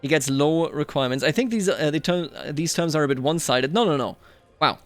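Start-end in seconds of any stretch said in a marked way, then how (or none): none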